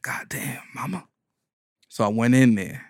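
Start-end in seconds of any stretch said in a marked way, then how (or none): 0.0-1.1 soft stunned whisper; whispered god damn. Retold or imitated. Reacting to his mama, whispered. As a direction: breathe it out, stunned.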